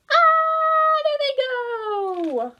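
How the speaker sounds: high excited cry, slowing down; excited shriek. A narrator's reading. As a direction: cry out as the chase starts. Hens chase the fox.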